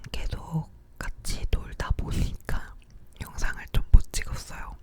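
normal talking voice

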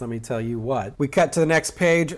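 usual speaking voice

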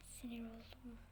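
"sinir oldum" whispered under the breath. Real, not acted. Player muttering to himself, whispering he is annoyed.

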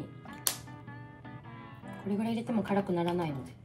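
normal talking voice

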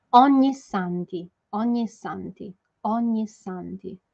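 teacher modelling ogni santi slowly; slow model pronunciation. Shown as a demonstration. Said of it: "Vamos fazer a pronúncia ogni santi".

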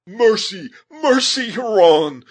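high, loud, horror-choked plea; horrified cry. A narrator's reading. Heyward calling from above for mercy, horrified. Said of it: "in tones nearly choked by horror".

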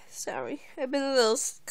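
normal talking voice

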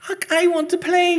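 put-on high, loud child's whine; whining. Wee sister dragged off from playing, whining. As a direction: whine to be allowed to play. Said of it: "she whines".

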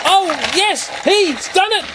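high, rising cheer of triumph; real delight as the engine makes it; excited cheer. Real, not acted. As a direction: celebrate the little train's win. Train finally makes it up.

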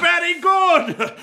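put-on delighted by the boy's fight; amused laugh. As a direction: laugh then a slow, high approving "very good". Marshal amused by boy's spirit.

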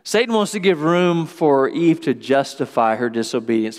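usual speaking voice

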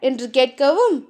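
usual speaking voice

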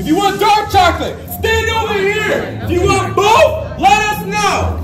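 excited guide. Real, not acted. Energizing the group about chocolate.

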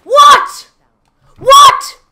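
high shrieked what; shrieking jackpot winner. Real, not acted. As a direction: shriek in stunned delight. A clip moment, shrieking.

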